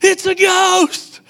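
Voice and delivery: frightened disciples crying out, hammed-up; mock terror. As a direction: ham up the disciples' panic. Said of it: "And they're like".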